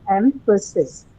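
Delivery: EMPHASIS slowly split as em-pha-sis; separate syllables. Shown as a demonstration. Teacher asks which syllable cannot be stressed, EMPHASIS split slowly. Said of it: "which one cannot take the stress".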